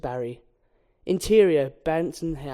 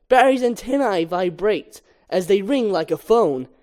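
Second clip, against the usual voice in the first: usual speaking voice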